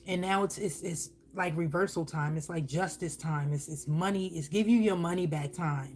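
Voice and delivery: ordinary delivery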